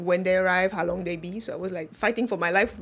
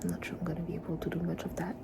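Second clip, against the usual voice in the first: soft breathy hospital whisper; real whisper; 4 semitones lower, 10 dB quieter than usual. Real, not acted. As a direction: whisper so as not to wake anyone.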